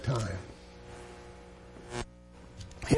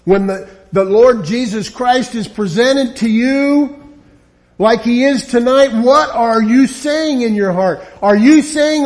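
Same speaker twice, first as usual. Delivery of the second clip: louder, higher fervent preaching; preaching at full force; 6 semitones higher, 8 dB louder than usual. Acted. Preacher confronting listeners tonight, fervent louder preaching. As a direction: drive the question home to the hearts.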